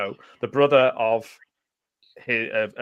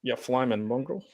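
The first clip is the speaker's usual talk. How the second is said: put-on Aussie accent, a touch softer; lightly mocked Australian lilt; 5 dB quieter than usual. Shown as a demonstration. Repeating the accent demo for Jason, soft Aussie accent. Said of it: "I can do an Aussie accent".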